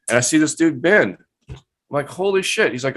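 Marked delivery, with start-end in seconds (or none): 2.1-2.7 pitched up, stunned disbelief; surprised. Retold or imitated. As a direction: blurt it out in shock. Seeing old coworker as owner.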